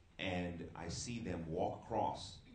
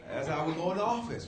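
laughing; 6 dB louder than usual. Real, not acted. Laughing after the bit.

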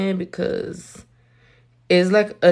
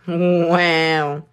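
ordinary delivery

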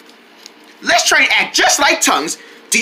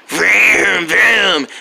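low rumbling engine imitation; car engine vroom; 4 semitones lower than usual. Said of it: "Am I driving a car".

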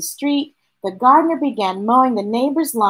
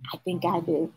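ordinary delivery, nothing marked

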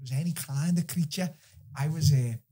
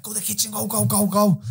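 urging shout; 6 semitones higher, 6 dB louder than usual. Retold or imitated. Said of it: "I was like".

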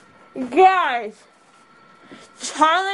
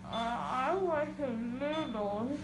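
mouth full; 3 semitones lower, 15 dB quieter than usual. Real, not acted. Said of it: "What did you say?".

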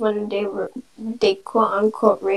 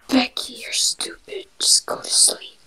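whispering. Pretend. Mocking a sleeping friend.

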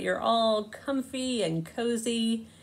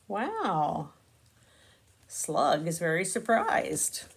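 ordinary delivery

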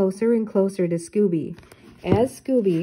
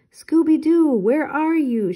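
loud high call, slow on the second part; searching shout; yelling; 8 semitones higher, 4 dB louder, slower than usual. A narrator's reading. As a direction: call across the snow looking for Scooby. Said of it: "Shaggy yelled".

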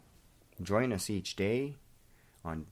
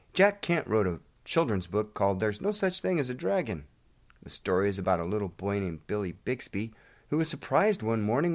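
put-on lively children's-story narration, higher and louder; animated story read; storytelling; 6 semitones higher, 6 dB louder than usual. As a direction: tell it like reading a picture book to kids. Retells a children's book.

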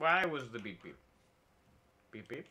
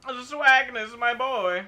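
1 cheering; 9 semitones higher, 6 dB louder, much slower than usual. Real, not acted. Cheering a teammate.

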